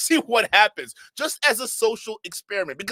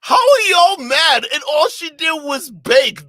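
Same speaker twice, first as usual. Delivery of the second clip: outraged yelling; 8 semitones higher, 10 dB louder, slower than usual. Real, not acted. Outraged at mocking a baker.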